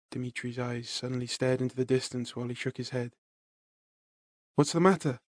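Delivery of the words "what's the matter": softer, cracking voice; voice breaking. Acted. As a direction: let the voice break on each word. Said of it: "My voice broke at the sound of each word".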